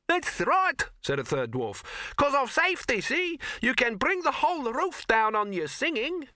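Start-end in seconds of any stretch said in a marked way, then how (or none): 0.1-0.8 very high squeaky chime-in; piping dwarf agreement; high-voiced dwarf. 2.2-6.3 very high, piping dwarf; small high-pitched know-all; high-voiced dwarf.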